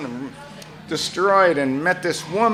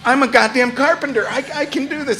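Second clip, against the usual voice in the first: meek cowboy; 9 semitones higher, 3 dB louder, faster than usual. Retold or imitated. Cowboy pleads he can fix.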